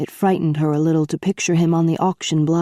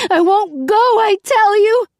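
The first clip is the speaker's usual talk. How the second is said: sobbing defiance; 5 dB louder than usual. A narrator's reading. Princess sobbing defiance at the captain, high and loud. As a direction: hammer the refusal through sobs. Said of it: "She sobbed and clenched her fists".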